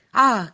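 loud, sharp, high cry of glee; a sudden loud ah, as if the prize is found; villain's loud cry of triumph; triumphant shout ah. A narrator's reading. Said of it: "gritó".

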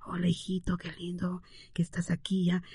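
soft unvoiced whisper to a baby. Shown as a demonstration. Parent whispering sweetly to newborn, hushed whisper. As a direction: breathe the words like a hushed lullaby. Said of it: "no susurrando".

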